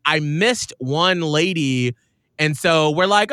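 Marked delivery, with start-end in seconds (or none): none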